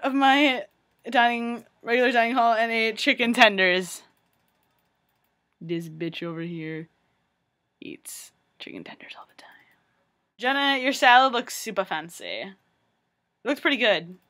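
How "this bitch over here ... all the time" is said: very soft, whispered dig; hushed aside. Real, not acted. Confiding about the friend beside her, hushed aside.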